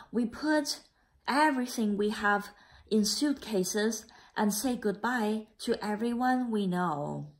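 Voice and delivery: put-on a gentle read-aloud for children; story narration. A narrator's reading. Teacher reading the class a picture book, gentle narration.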